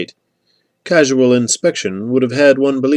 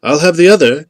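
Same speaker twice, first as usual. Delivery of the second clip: lightly voiced aristocrat calling out, higher and louder; a hint of aristocrat in a raised voice; aristocratic voice; 6 semitones higher, 7 dB louder than usual. A narrator's reading. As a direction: claim it as an aristocrat across a distance. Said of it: "came an aristocratic voice from the opposite direction".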